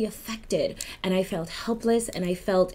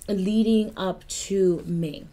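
ordinary talk

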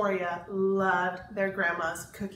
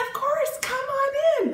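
put-on much higher, bright welcoming child; 6 dB louder, faster than usual. A narrator's reading. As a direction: invite them in like an eager kid. Kids welcome friends.

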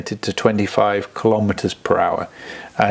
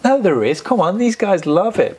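excited; 10 semitones higher than usual. Real, not acted. Excited disagreement with partner.

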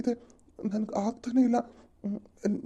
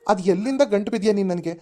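louder, resentful scolding of his wife; angry outburst; 7 dB louder than usual. Acted. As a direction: snap at her as if she ruined your life. Husband scolds his wife angrily. (ಹೆಂಡತಿಯ ಮೇಲೆ ಸಿಟ್ಟಿನಿಂದ ಜೋರಾಗಿ ಬೈಯುವುದು)

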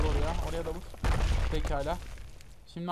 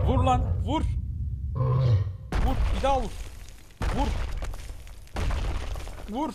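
higher-pitched urging at the game enemy; kışkırtma; 6 semitones higher than usual. Real, not acted. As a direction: egg them on to strike, pitched up. Egging on the game enemy to hit. (yüksek perdeden kışkırtarak)

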